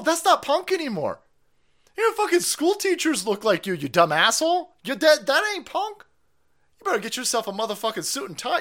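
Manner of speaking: high, loud scolding rant; mocking rant. Real, not acted. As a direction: tear into her like a disgusted uncle. Ranting at the person in the clip.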